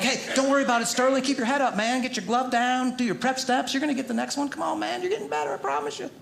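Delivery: put-on sugary pep talk for someone else's kid; sweet encouraging coach. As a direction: coax a struggling kid along kindly. Encouraging other kids as coach.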